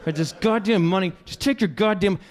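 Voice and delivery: high-pitched exasperated mock rant; retold angry rant. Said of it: "So I'm getting angry".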